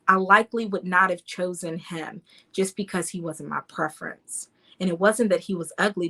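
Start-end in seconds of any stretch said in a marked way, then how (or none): none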